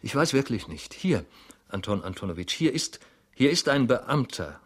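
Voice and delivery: mildly tearful, broken-up phrasing; near tears. A narrator's reading. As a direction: struggle to get it out, upset. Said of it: "die Tränen in die Augen traten".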